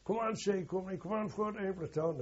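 rally the platoon, mildly shouted; retold rallying shout. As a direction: soldiers urging their company forward. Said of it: "we were shouting".

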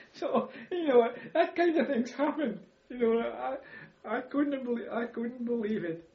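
high, breathless laughter through the words; laughing. Real, not acted. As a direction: laugh your way through the disbelief. Laughing after the army story.

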